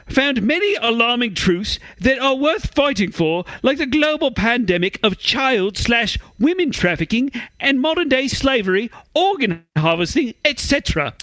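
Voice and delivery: exaggerated put-on British accent; mock British woman. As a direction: raise the pitch and caricature a British lady. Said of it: "like a prostitute on a British railway".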